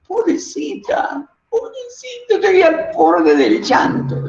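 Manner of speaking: high, trembling voice breaking into sobs; exaggerated near tears. Shown as a demonstration. Teacher overacting the frightened princess for students, tearful and trembling. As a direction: ham up the princess's fear until close to crying. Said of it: "estoy exagerándolo".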